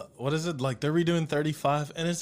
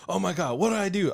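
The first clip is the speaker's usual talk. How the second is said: panicked; 5 semitones higher than usual. Retold or imitated. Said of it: "she was like".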